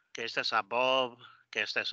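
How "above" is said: "above" with Spanish vowels, a-bob; read as in Spanish. Shown as a demonstration. Teacher showing learners' reading, Spanish-style pronunciation. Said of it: "como se lee en español".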